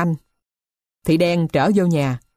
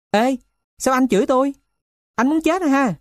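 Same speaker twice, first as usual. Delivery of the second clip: put-on high-pitched indignant boy; higher, confrontational questioning; defiant challenge; 8 semitones higher than usual. A narrator's reading. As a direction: answer the insult with a threat. Cursed boy challenges the man.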